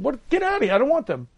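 high, fast, exasperated mock outburst; a quick, high-pitched mock protest; shooing thoughts away. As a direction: shoo the thought off in a hurry.

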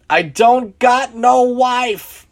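high, slow, loud wail; drawn-out, acted lament at high pitch; sobbing. A narrator's reading. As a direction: wail each line out like a sobbing drunk. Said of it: "he began to sob".

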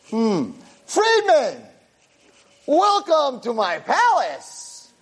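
high, drawn-out grand welcome; hearty, stretched-out storyteller's welcome; effusive greeting. Acted. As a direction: sing out a big, slow hello to a new roommate. Acted cheerful greeting to new roommate.

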